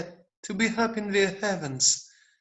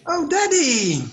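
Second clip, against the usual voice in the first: high, loud happy cry; overjoyed at his father; joyful cry; 9 semitones higher, 5 dB louder than usual. Acted. The boy cries out to his father with joy, higher and louder.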